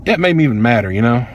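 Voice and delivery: plain reading voice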